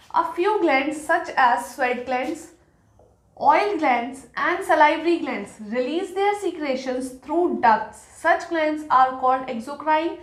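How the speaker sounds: very slow, word-by-word note dictation; slow classroom dictation of an English note; dictating notes. Acted. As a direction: read it out slowly so the class can write it down.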